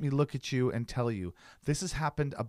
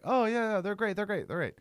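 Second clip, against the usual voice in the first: higher, over-eager yeah they're great; fake polite agreement; 7 semitones higher than usual. Telling about the dog-loving stranger, higher polite gushing. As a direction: nod along politely to get away. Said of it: "I'm like".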